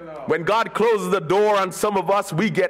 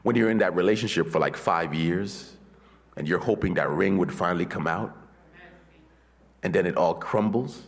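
lower, softer, intimate sermon voice; quiet preaching; 13 semitones lower, 5 dB quieter than usual. Acted. Preacher softly recounting closed doors to the congregation.

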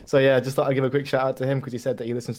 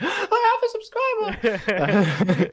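much higher, slow, laughing joy; excited kid; 5 dB louder, much slower than usual. Retold or imitated. Retelling his young self's joy at a first subscriber, high laughing excitement. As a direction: squeal it like a thrilled child.

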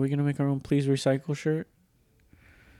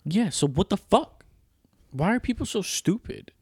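exasperated rant; 9 semitones higher than usual. Real, not acted. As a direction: vent in disbelief. Rant about recycling.

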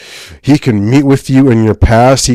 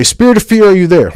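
higher, louder questioning call; addressing a demon; 8 semitones higher, 6 dB louder, faster than usual. Retold or imitated. Deliverance pastor questioning a spirit of fear, calling it out. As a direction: call out loudly to an unseen spirit. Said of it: "they would be talking to demons".

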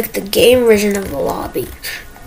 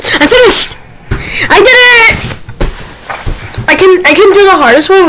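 excited; 9 semitones higher, faster than usual. Real, not acted. Excited after winning round.

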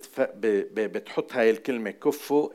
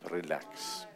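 soft, low relax; 7 semitones lower, 11 dB quieter than usual. Acted. After verse, calming word.

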